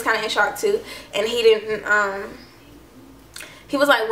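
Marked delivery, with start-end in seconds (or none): none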